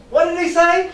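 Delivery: high, loud, incredulous question; astonished cry. A narrator's reading. Frogs cry out at the pig's answer, astonished. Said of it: "cried the frogs".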